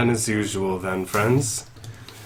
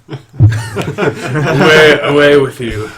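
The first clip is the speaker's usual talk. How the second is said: laughing; 6 semitones higher, 11 dB louder than usual. Acted. Voicing drunk dismissal, laughing.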